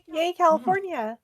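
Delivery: ordinary talk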